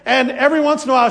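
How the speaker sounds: usual speaking voice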